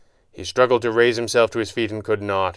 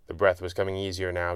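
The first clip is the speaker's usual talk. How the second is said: usual speaking voice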